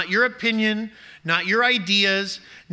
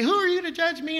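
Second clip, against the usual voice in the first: much higher, indignant mock sneer; mocking high and mighty; 10 semitones higher than usual. Preacher mimics a sinner rebuffing correction, mock-indignant. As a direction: parody a defensive sinner's huffy retort. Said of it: "Don't get all high and mighty and say".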